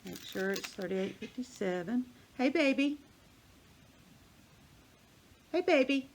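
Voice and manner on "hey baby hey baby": high, loud pet-talk voice; cooing greeting to a pet bird. Real, not acted. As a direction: coo sweetly at the duck. Talking to pet duck.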